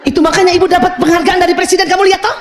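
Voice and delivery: high, hurried self-justification; defensive mother. Acted. Mother defending her work to her child, high and defensive.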